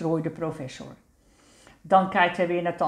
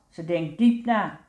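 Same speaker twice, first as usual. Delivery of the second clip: ordinary delivery, nothing marked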